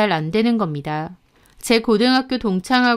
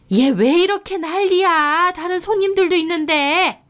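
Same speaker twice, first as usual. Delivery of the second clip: exasperated mother-in-law; 8 semitones higher than usual. Acted. Mother-in-law scolds wife again. (짜증 내는 시어머니 목소리)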